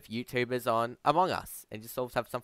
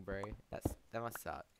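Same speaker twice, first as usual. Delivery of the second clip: ordinary talk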